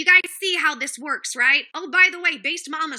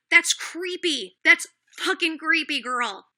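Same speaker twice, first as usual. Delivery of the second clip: slow emphatic disgust; slower than usual. Real, not acted. Calls out Nicole directly.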